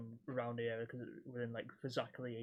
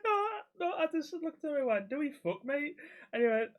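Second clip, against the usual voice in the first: laughing; 14 semitones higher, 6 dB louder than usual. Real, not acted. Laughing while retelling the joke.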